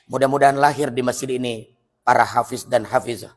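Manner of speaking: loud, formal announcer voice; preacher formally announcing a Quran donation; formal announcement. Acted. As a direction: declare the donation to the whole mosque.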